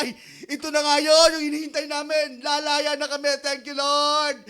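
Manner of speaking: high, loud jubilant cry; shepherds' joy. Acted. Shepherds rejoicing at the news, loud and high. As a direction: shout it out like long-awaited freedom.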